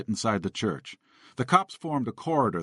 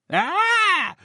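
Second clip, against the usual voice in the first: very high startled shriek; startled shopper shrieking at the zombie; 6 dB louder than usual. A narrator's reading. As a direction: shriek in fright. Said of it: "the shopper shrieked".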